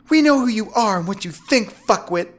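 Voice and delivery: loud, high, hostile woman's voice; contemptuous female captor; Veronica. A narrator's reading. Veronica turns hostile on captive.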